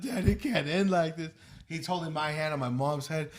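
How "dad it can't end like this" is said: imitating an upset kid pleading with dad; louder mock-tearful appeal; crying plea. Dad retells his son's plea as the game ends, mild crying voice. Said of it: "He was crying towards the end".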